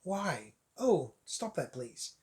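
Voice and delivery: soft, weary groan of a plea; mock-pained muttered please; exasperated plea. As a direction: beg the noise to stop, fading to nothing. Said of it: "you're like".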